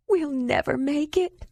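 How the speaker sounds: high, tearful voice giving up; crying despair. A narrator's reading. Despairing, crying. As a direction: Ayla crying to Jandalar on melting ice. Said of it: "then started crying".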